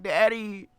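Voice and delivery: put-on high, loud little-girl cry of Daddy. Boy stung by ants calls his dad, little-girl squeal. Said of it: "I said like a little girl".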